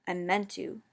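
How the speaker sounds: ordinary delivery, nothing marked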